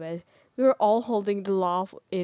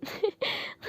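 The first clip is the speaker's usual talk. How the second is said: high, airy little laugh; light giggle; 12 semitones higher, 3 dB quieter than usual. Acted. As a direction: giggle at his fluster. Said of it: "She laughed at my actions".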